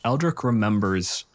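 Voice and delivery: usual speaking voice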